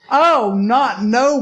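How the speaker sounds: slow, loud mock indignation; emphatic refusal. Acted emphatic refusal in retold story.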